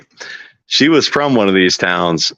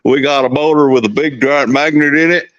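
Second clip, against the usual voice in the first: put-on Texas accent; 3 semitones higher than usual. Texan showing off new motors to viewers, hammed-up Texas accent. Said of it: "I never got that accent".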